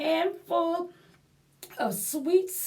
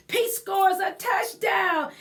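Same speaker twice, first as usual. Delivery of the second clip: plain reading voice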